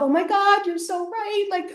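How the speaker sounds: high, mock tearful pleading; old school [name]. Old self sobbing agreement to yelling ex-boyfriend, high mock tearful. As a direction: imitate her weepy, eager-to-please old self. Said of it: "bawling my face off".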